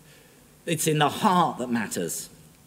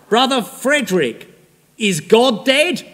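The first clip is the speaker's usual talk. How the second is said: put-on high, loud challenging cry of an old woman; voicing Sojourner Truth; 8 semitones higher, 7 dB louder than usual. Preacher voicing her challenge to Douglass, high and loud. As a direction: call out sharply to Frederick Douglass.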